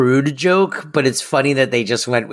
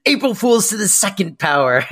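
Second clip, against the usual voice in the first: nerdy prank reveal; 6 semitones higher than usual. Retold or imitated. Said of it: "which is the nerdiest thing I've ever".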